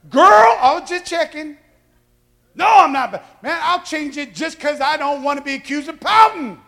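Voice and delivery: high, loud, offended denial; mock indignant shout. Husband denying he pouts to wife, loud and indignant. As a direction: bark it like a proud man insulted. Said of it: "husband retort".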